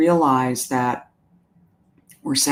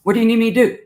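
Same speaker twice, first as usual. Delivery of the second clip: higher and louder imitating the worker's eager offer; mock-helpful clerk, pitched up and loud; eager employee; 8 semitones higher, 6 dB louder, much faster than usual. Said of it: "it was kinda like".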